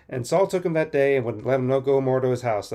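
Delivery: ordinary talk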